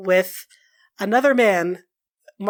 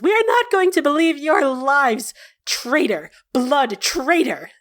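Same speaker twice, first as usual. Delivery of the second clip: accusing traitor; 5 semitones higher than usual. Acted. Elf accusing Leander of betrayal, mild contempt. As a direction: sneer the accusation.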